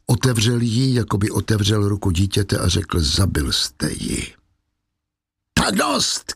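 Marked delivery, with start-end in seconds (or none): 3.0-4.3 low, slow, understated accusation; grave accusation. A narrator's reading. Valjean accuses Javert over death. 5.5-6.3 furious cry. A narrator's reading. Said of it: "křikl Javert vztekle".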